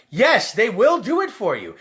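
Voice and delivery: ordinary delivery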